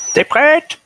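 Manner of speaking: put-on high, creaky old-timer voice; little old voice. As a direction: play a frail little old man. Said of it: "leur petite voix de vieux".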